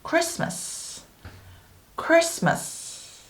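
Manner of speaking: "Christmas" with no T, as kris-mus; careful model of "Christmas", T left out; silent T. Shown as a demonstration. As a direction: say "Christmas" slowly, going from S straight to M. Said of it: "크리스마스에서도 T가 발음이 안 나죠".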